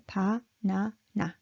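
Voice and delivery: "Pa, na, na" in even, separate beats; evenly spaced "Pa, na, na"; Korean equal timing. Shown as a demonstration. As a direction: pronounce "Pa, na, na" low, each syllable equal. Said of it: "how it is pronounced".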